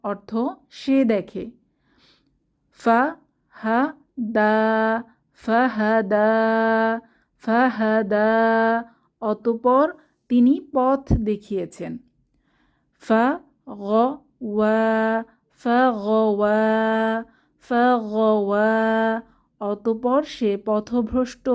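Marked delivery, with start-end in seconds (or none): 2.8-8.3 ফা হা দা spelled out syllable by syllable, then blended. Shown as a demonstration. Teacher drilling a Quran word for sisters, slow and clear. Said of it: "একসাথে পড়ব". 13.0-18.7 ফা গ ওয়া spelled out syllable by syllable, then blended. Shown as a demonstration. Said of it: "একসাথে পড়ব".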